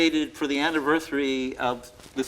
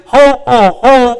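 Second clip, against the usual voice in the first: loud jeering Santa laugh, higher; mock ho ho ho; 10 semitones higher, 16 dB louder, slower than usual. Brats jeering at the bearded man, loud mocking ho ho ho. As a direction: taunt him with a bratty Santa call. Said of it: "being jeered with".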